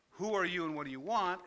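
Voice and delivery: suspicious homeowner snapping at a stranger; gruff challenge. Pretend. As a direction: bark it like a wary man at his door. Imagined man answering door.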